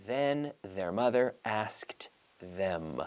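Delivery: "Then their mother asked them" very slow with a voiced th; slowly, carefully, tongue out on "Then"; slow model read. Shown as a demonstration. Teacher modelling the tongue twister slowly for practice at home. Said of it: "So try it at home".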